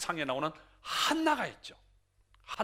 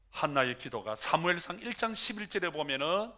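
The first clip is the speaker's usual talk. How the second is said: ordinary talk, nothing marked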